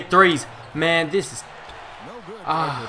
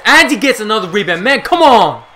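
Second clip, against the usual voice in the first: loud, higher, fast complaint building to a shout; exasperated yell; 4 semitones higher, 10 dB louder than usual. Real, not acted. Gamer watching another rebound, exasperated yell.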